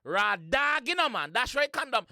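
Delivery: loud, high, shouting over the track; hyped. Real, not acted. Reactor shouting along to a Jamaican track, hyped. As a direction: hype the lyric up like you can't believe it.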